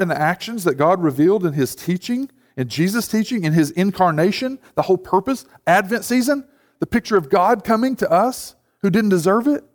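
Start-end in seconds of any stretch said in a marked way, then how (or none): none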